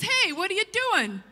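high, loud "hey" of alarm; startled protest. Retold or imitated. Driver shouting at officer writing a second ticket, outraged.

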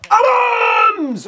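high, loud name call; excited shout. Real, not acted. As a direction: scream his name in hope. Cheering player's shot.

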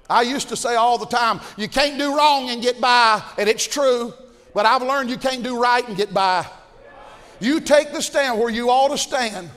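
loud, rising preacher's shout; preaching at full force. Acted. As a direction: drive it home from the pulpit.

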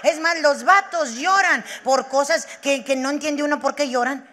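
usual speaking voice

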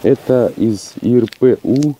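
ordinary talk, nothing marked

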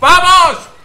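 very high, loud celebration; full-voice, high-pitched cheering; triumphant shout. Real, not acted. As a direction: yell it out like a crowing winner. Cheering a game success.

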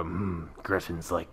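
slow, low, gruff monster hunter; Geralt voice. Retold or imitated. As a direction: growl it out slowly like a weary witcher. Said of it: "sounded oddly like the witch Geralt from The Witcher".

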